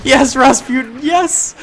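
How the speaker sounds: high, loud celebratory yes; excited cheering. Real, not acted. Cheers surviving favourite character.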